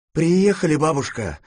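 high, loud announcement; calling out. A narrator's reading. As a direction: call out to her on arrival. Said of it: "закричал я". (громкий оклик)

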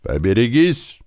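high call, rising at the end; barked warning. A narrator's reading. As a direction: warn everyone to stand clear. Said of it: "рявкнул второк". (предостерегающий окрик)

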